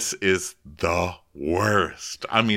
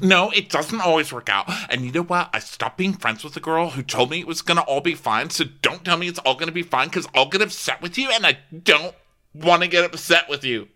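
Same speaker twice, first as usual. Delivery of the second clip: mock tense friend; 7 semitones higher than usual. Imitating Casey's tense reply.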